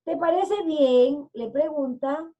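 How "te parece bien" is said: "¿Te parece bien?" slow, high and rising; question tune. Shown as a demonstration. Wolf proposes a race, asking "¿Te parece bien?" with raised question tune. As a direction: ask "¿Te parece bien?" louder, clearly lifted as a question. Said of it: "es una pregunta".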